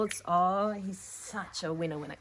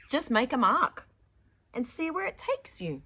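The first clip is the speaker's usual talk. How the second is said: encouraging; 7 semitones higher, 8 dB louder than usual. Acted. Teacher encourages Vashti.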